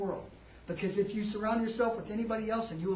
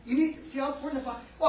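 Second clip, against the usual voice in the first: normal talking voice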